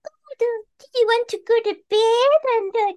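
mock someone coddling you; sugary baby talk. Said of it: "talked to like a baby".

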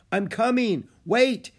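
high, loud call after them; urgent shout up the slope. A narrator's reading. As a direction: yell so they wait. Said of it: "he shouted".

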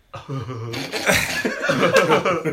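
put-on villain laugh, climbing in pitch; Keanu-style Joker laugh. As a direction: channel a dude playing a cackling villain. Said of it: "impression of what we think Keanu Reeves' Joker laugh".

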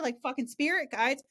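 normal talking voice

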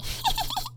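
short high cackling laugh; loud cackle. Shown as a demonstration. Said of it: "kinda cackly".

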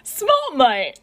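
high, loud, delighted cry; thrilled fan spotting an idol; grinning exclamation. A narrator's reading. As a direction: exclaim it through a grin. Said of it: "Tamura grins".

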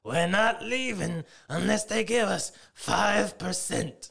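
high, loud, very slow cartoon clown rasp; Krusty the Clown voice. Joking about the new union boss, Krusty the Clown impression. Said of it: "You sound like Krusty".

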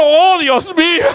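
high, loud, slow alarmed cry; the sudden panic of forgetting the oven; alarmed realization. Retold or imitated. Realizes the lasagna is burning. (exclamación alarmada, aguda y fuerte)

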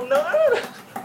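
shrieking high no; frantic denial. Acted. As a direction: scream the nos, very high. Rejecting, escalating.

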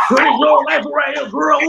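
excited fan shout. Retold or imitated. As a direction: call out like a thrilled fan. Fans going crazy in New Orleans.

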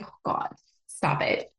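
whispered, flustered oh God; a flustered aside whispered to herself; embarrassed whisper. Retold or imitated. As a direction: breathe it out, mortified. Embarrassed inner reaction retold.

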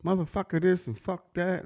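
lightly voiced mouthy kid; imitated cussing kids. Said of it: "talking real loud and cussing".

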